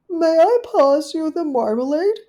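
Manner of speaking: high, loud genteel caller; a polite little dog at the door; Duchess's voice. Acted. Duchess calling at Rivy's porch, high and genteel.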